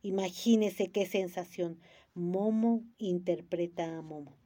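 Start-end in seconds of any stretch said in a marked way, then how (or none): none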